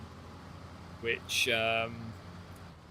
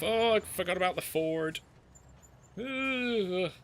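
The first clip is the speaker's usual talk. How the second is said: surprised; 7 semitones higher than usual. Real, not acted. Forgot the ford while driving.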